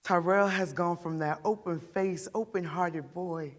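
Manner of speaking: put-on remember the children's lost innocence, quietly pained; understated grief of a mother; grieving mother.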